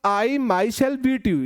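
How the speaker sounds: "I myself beat you" very slow, each word separate; slow emphatic example. Shown as a demonstration. Teacher to students, emphatic example, very slow. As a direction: lay out every word for the class. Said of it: "लेकिन मैंने बोला".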